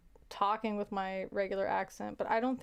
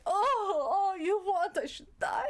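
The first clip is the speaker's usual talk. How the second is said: wailing grandma; 9 semitones higher, slower than usual. Retold or imitated. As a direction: howl the guilt trip like grandma. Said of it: "my grandma would always go".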